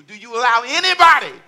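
loud, high preacher's shout; impassioned, shouted challenge to the congregation; full-force preaching. Real, not acted. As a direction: drive each question home at the top of your voice.

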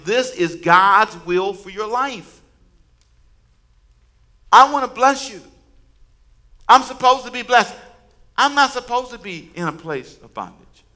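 high, loud, slow proclamation; fervent preaching. Acted. Preacher voicing God's blessing, loud and rising. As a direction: cry it out as God's promise. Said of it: "This is him saying".

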